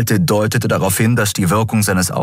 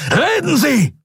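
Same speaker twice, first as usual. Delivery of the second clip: roared, much higher; bellowing. A narrator's reading. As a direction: bellow the order. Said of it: "brüllte Thelios".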